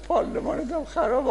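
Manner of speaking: high, thin mock of پارلمانتم خرابه; squeaky high mimicry; mimicking the student. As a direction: parrot it like a clueless young student. The student repeats the slogan.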